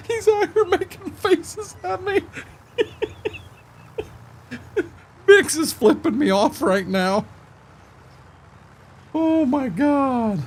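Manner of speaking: high, squeaky talk through laughter; real giggles pushing the voice way up; helpless laughing. Real, not acted. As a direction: gasp it out between fits of laughter. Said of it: "Hey, I'm laughing".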